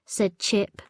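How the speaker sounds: ordinary delivery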